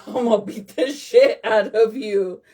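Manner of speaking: laughing through a tough threat; half-laughing as she boasts to her viewers about a fight. Real, not acted. Laughing threat.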